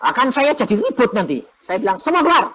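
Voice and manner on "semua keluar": commanding order. Retold or imitated. Ordering family out of room.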